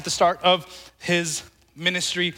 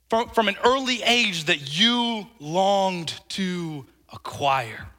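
slow, reflective emphasis; slower than usual. Real, not acted. Preacher asking listeners to think about their life, reflective. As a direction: stretch it out, then drop on the last word.

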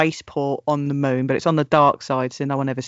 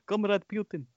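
mock Russian accent; 6 semitones higher, 8 dB quieter than usual. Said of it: "see, you can do it".

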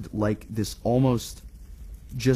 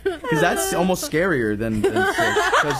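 high-pitched giggling talk; laughing; 10 semitones higher, 4 dB louder than usual. Real, not acted. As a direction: giggle through the words. Laughing about the ghost doctor.

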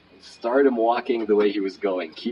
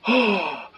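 high, imitated gasp of amazement; astonished gasp; 10 semitones higher than usual. Imitating a homeless man handed a twenty, astonished gasp.

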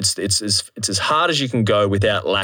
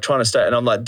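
normal talking voice

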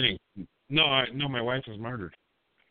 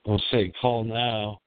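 low magnetic voice; 10 semitones lower, slower than usual. Acted. Late-night radio persona.

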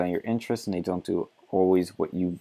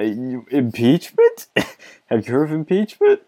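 incredulous; 4 semitones higher, 6 dB louder than usual. Real, not acted. Mocking the article's claim.

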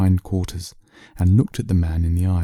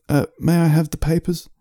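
higher, timid ask with a hesitant uh; politely edge away from danger; nervous request; 9 semitones higher than usual. Acted. Man nervously asking the lion for his papers, timid. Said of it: "wishing to get away with his details as quickly as possible".

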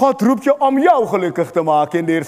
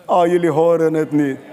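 ordinary talk, nothing marked

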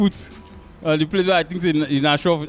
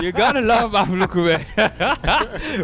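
laughing; 7 semitones higher, slower than usual. Real, not acted. Joking about drinking on a Tuesday.

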